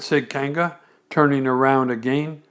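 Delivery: usual speaking voice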